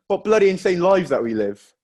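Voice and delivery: ordinary talk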